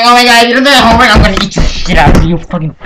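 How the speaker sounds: low, rushed mock teacher voice, fading at the end; LSP. Teacher threatening to eat a pupil over homework, mocking impression. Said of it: "she's like fucking L S P".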